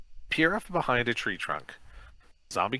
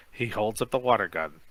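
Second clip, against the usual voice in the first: usual speaking voice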